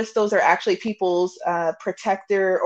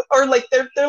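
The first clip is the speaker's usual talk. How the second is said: ordinary delivery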